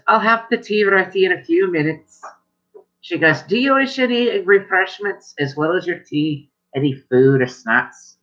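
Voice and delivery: sing-song polite host offering tea and snacks; dwarf hostess. Acted.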